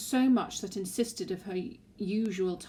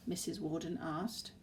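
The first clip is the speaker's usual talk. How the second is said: ordinary delivery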